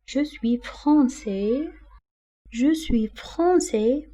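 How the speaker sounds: very slow, carefully articulated; slow teacher repetition; slow model sentence. Shown as a demonstration. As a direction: spell it out slowly for beginners. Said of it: "et on dit la nationalité".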